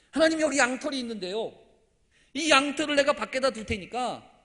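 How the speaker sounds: loud, high-pitched plea; insistent appeal. Acted. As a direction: call up to God, laying out the terms. Gideon appealing to God with a test. (크고 높은 소리로 간청하듯)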